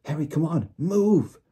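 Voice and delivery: high, loud, urgent command; terrified friend hurrying someone away; panicked urging. A narrator's reading. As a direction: build to a frantic shout on the last word. Hermione urgently tugs Harry away.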